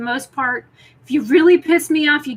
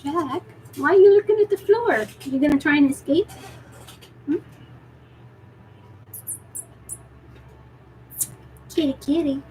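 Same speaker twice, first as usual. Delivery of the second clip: cooing pet talk; 4 semitones higher than usual. Real, not acted. Cooing to her cat.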